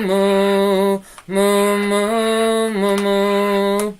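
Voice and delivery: announced triple moo, done playfully; mooing like a cow. Pretend. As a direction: moo like a cow in a cheery rhythm. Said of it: "let's do a triple moo".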